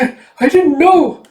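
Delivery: very high, loud stammer; stammering girl. Acted. Erica protests she didn't know, very high and loud. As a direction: blurt it out in shock. Erica shocked about poison.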